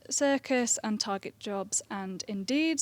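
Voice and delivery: usual speaking voice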